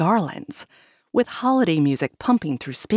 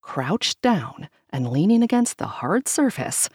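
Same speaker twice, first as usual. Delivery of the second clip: ordinary delivery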